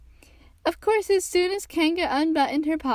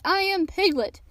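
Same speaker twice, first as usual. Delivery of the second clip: high, emphatic declaration; loudly; 6 semitones higher than usual. A narrator's reading. Piglet insisting on who he is, loud. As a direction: declare it with raised pitch. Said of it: "said Piglet loudly".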